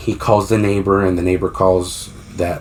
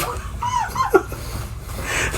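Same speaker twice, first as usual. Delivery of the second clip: high breathless laugh; 8 dB quieter than usual. Real, not acted. Laughs at the hang-up.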